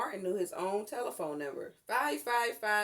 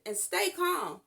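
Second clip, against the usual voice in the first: louder, higher urgent reassurance; firm instruction; 9 semitones higher, 5 dB louder, slower than usual. A narrator's reading. Mother urging daughter to stay calm, firm. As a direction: insist she keep calm. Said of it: "her mother instructed".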